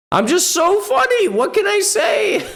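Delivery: squealed, very high gloating; hammed-up high-pitched delight; mock self-congratulation. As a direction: squeal it through mock laughter. Mock gloating over own pun.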